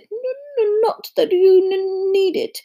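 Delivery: high, jittery stuttering professor; nervous stammer. A narrator's reading. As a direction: stutter into an uneasy little joke. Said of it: "He laughed nervously".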